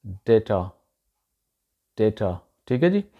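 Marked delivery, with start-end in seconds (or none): 0.1-0.7 डेटा with a long 'ay' in the first syllable; correct pronunciation. Shown as a demonstration. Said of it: "इसको बोलते हैं". 2.0-2.5 डेटा with a long 'ay' in the first syllable; correct pronunciation. Shown as a demonstration. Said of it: "इसको बोलते हैं".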